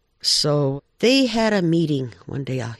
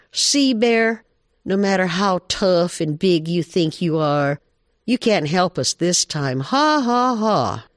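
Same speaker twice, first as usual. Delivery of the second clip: higher-pitched jeer with a spoken "ha, ha, ha"; mocking taunt; 5 semitones higher, 4 dB louder than usual. Jackrabbit and bobcat mock Bear at the meeting, high taunting. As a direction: tease the bear, ending in a high mock laugh.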